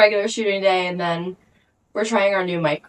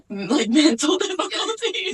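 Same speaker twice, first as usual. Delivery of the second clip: laughing; 7 semitones higher than usual. Real, not acted. Laughing at own joke.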